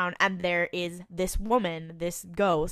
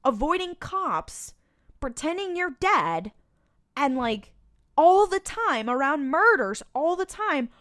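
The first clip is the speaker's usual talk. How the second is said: exasperated rant; 8 semitones higher, 4 dB louder than usual. Retold or imitated. Exasperated rant at careless characters.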